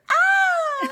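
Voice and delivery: high startled shriek; nightmare scream. Acted. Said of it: "wakes up from the nightmare with an".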